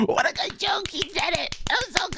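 cheering crowd voice. Pretend. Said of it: "Everyone applauds".

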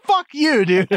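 high, loud brotherly jab; mock shout. Teasing older brother shouting at his younger sibling, loud and high.

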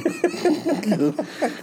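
real high chuckle; laughter. Real, not acted. Co-host amused by the actress cracking up, real laughter. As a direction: laugh lightly at the anecdote.

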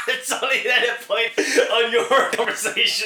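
high voice breaking with real laughter; laughing. Real, not acted. Podcaster cracking up over edit points, laughing high. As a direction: talk through a laughing fit.

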